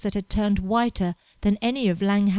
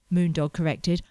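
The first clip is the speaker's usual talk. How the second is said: ordinary delivery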